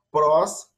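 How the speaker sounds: Prós set up as a new term; model prós. Shown as a demonstration.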